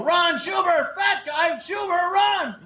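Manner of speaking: high, bellowing coach shout; coach yelling. Retold or imitated. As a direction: bark it across the field like a gym coach. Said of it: "always be yelling".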